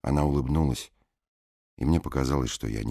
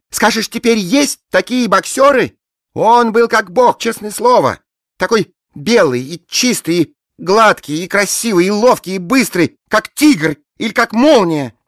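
gushing blonde woman; 15 dB louder than usual. A narrator's reading. Bleached blonde rhapsodising over her dead boxer, high and loud. Peroxide's voice in the story.

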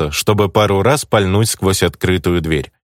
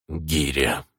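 much softer, low and clenched; through gritted teeth; 5 semitones lower, 10 dB quieter than usual. A narrator's reading. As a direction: grind the name out. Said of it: "процедил сквозь зубы". (процедил сквозь зубы)

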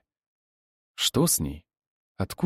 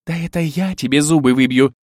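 higher, louder angry retort; snappish counter-threat; 4 semitones higher, 5 dB louder than usual. A narrator's reading. Bride returning her rival's threat, snappish angry retort. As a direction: throw the threat straight back. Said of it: "огрызнулась Ольга".

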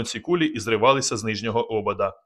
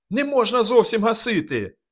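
panicked shout; 11 semitones higher than usual. A narrator's reading. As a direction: cry out to stop him. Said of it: "закричав Казик".

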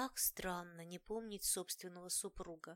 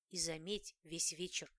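ordinary delivery

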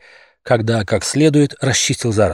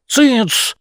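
sharp nasal hush; 12 semitones higher than usual. Acted. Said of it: "гнусавым голосом добавил Дрозофил".

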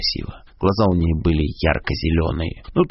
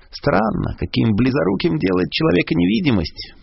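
put-on high-pitched, musing old professor; lofty, gently puzzled remark; Dumbledore; 10 semitones higher than usual. A narrator's reading. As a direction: muse aloud in a much higher voice. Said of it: "произнёс Дамблдор".

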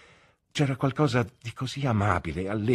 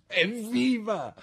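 joyful hurrah; 13 semitones higher, much slower than usual. A narrator's reading. Traddles cheers as his friend congratulates them, gleeful. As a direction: cheer with glee. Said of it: "esclamò Traddles".

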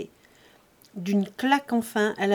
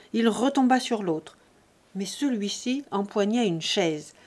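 plain reading voice

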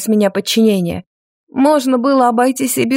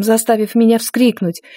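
plain reading voice

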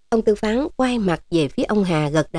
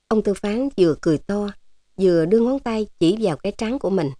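ordinary delivery, nothing marked

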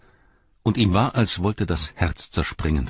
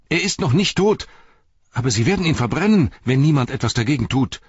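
high, loud and fast; panicked shouting; 8 semitones higher, 5 dB louder, faster than usual. Acted. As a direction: blurt it out in a frantic rush. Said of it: "schrie Pippin". (hastig, laut und panisch)

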